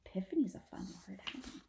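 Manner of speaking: fast, breathy whisper to the listener; quick whispered aside. Real, not acted. Narrator whispering a quick aside about a fun word. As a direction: rush it out as a shared secret.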